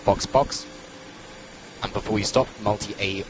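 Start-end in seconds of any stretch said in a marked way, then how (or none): 0.0-0.7 urgent pit call over radio; race spotter radio. Real, not acted. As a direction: call him in, crisp and high. Engineer calling box over radio.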